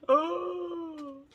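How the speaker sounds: long, very high cooing cry; delighted squeal. Real, not acted. Seeing the neutral shades, delighted squeal.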